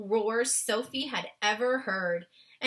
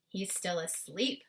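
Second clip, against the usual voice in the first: usual speaking voice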